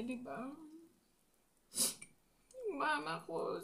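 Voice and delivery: tearful voice, high and breaking; crying while talking. Real, not acted. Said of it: "Naiyak ako".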